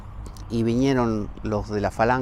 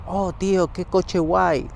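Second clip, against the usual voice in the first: higher, drawn-out mock gush; mock gushing slang; 6 semitones higher, slower than usual. Gawking at a passing car, mock slang gush. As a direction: drag it out like a dazzled teen. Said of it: "pasa un coche buenísimo y digo".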